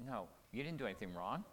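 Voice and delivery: soft, patient and reassuring; calm her down gently; a hushed, kind explanation to a worried wife; gentle explanation. Shown as a demonstration. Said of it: "you go back and you say".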